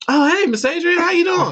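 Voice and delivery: high-pitched acted hello; put-on high voice greeting; mock casual greeting. Acts himself greeting neighbor after waking.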